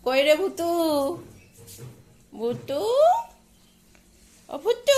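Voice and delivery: high, loud call to the dog. Real, not acted. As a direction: sing out her name across the yard. Calling the dog from afar. (কুকুরকে জোরে উঁচু গলায় ডাকা)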